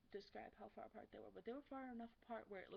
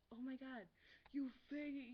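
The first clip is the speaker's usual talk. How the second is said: raised, louder imitation of kids swearing; mock swearing kids; 4 semitones higher, 4 dB louder than usual. Imitating kids swearing at the park, mock swearing. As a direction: imitate kids hurling an insult. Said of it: "they were swearing".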